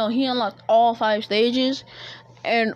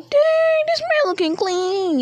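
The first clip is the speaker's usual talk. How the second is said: impressed crowd; 3 dB louder than usual. Acted. Crowd admiring Naruto's outfit.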